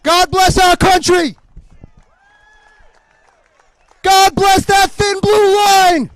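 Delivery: loud, fervent rally cry, the second line drawn out slow; fervent crowd-rousing shout. Real, not acted. Rally speech crescendo.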